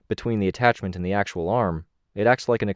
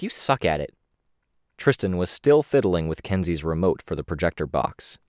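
ordinary delivery, nothing marked

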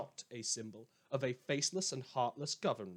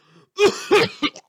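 loud hacking cough, way up high; harsh coughing fit; 20 dB louder than usual. Acted. Radio host hacking helplessly on air, loud harsh cough. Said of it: "penalties of live broadcasting".

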